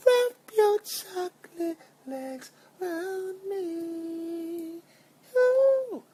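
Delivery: very high, slow, loud falsetto swoon; dreamy high falsetto plea. Pretend. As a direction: croon it like a lovestruck diva. Swooning fantasy line.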